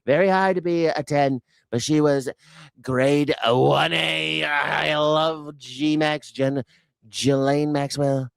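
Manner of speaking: hammed-up Trump bragging; Trump impression. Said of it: "That is what he said".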